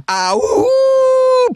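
long high whooping cheer; cheering woo-hoo. Real, not acted. Reading a fan review, cheering in a stretched high whoop. Cheering after problem solved.

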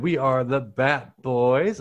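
slow hyped show announcer. Acted. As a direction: announce the show's name slowly. Show outro announcer read.

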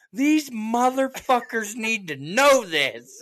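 high, loud and slow, every word punched; mock outraged declaration. Telling his wife the shoppers deserve to hear it, loud and slow. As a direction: declare it loudly so the whole store hears. Ranting in store.

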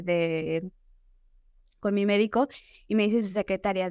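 usual speaking voice

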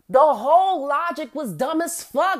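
ordinary talk, nothing marked

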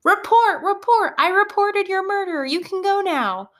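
put-on high, urgent kid's announcement; excited student. Student running up to free a classmate, high excited call. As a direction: call it out high like a child playing tag. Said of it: "another student comes running up and tags".

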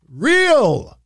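"real" shot up high and loud; stress on real. Real, not acted. Host mocks a wrestler's missing accent, "real" punched high and loud. Mock emphasis before an accent joke.

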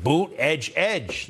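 "Boot, edge, edge" said as separate syllables BOOT and EDGE; Buttigieg pronunciation. Shown as a demonstration. Host teaching viewers to say Buttigieg, syllable by syllable. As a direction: spell out "Boot, edge, edge" as its sounds. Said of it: "So the way to say Buttigieg".

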